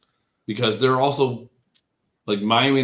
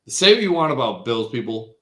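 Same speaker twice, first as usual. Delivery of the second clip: normal talking voice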